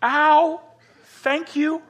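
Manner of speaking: high-pitched mock-hurt reply; pained thanks. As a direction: wince through a polite thank you, high. Dad's reply to deafening yell.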